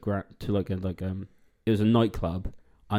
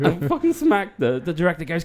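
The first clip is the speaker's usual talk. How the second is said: ordinary talk, nothing marked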